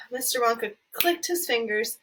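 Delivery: ordinary delivery, nothing marked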